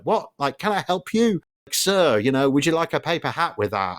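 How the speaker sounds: high, prim voice-assistant tone; fussy car assistant. Retold or imitated. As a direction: play the nosy talking car butting in. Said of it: "the car was like".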